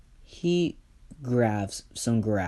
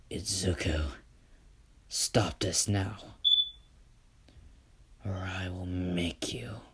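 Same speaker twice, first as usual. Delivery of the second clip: mild hushed warning; 2 semitones lower, 5 dB quieter than usual. Acted. Enoch shouts at Izuku.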